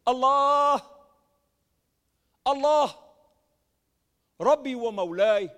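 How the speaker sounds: loud anguished cry to God; desperate loud plea. Retold or imitated. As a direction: call out to Allah at full voice. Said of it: "I cried out with a loud voice".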